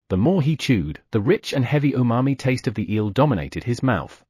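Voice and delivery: plain reading voice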